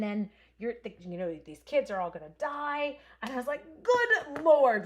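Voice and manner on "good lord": loud retold exclamation, pitch up; mock outraged shout. Retelling a confrontation to viewers, loud mock shout. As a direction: boom it out like she's scolding the lady again.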